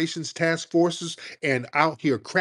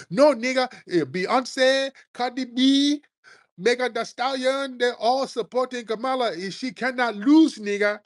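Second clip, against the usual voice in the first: high-pitched smug mock voice; lightly voiced smug supporter; mocking boast; 10 semitones higher than usual. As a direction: parody the cocky Kamala fans.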